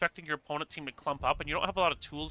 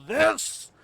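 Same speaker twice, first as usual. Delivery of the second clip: drawn-out, high, loud team-name call; hype shout; 5 semitones higher, 10 dB louder, much slower than usual. Acted. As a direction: stretch the name out like a ring announcer.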